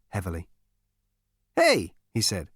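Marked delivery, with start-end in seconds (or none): 1.5-1.9 brash Zaphod. A narrator's reading. Zaphod spots cake.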